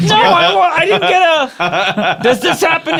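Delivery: high-pitched laughing complaint. Real, not acted. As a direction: complain while cracking up. Laughing through protest.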